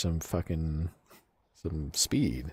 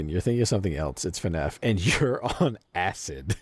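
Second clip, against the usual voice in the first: normal talking voice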